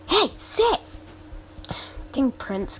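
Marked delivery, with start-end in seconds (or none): 0.1-0.8 high sharp command to a pet; shouted command. Real, not acted. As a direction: yell sit at a disobedient pet. Commanding the baby dragon pet.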